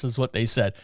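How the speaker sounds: ordinary talk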